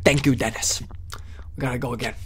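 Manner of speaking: soft whispered thanks. Real, not acted. Streamer thanks a viewer under his breath, whispering.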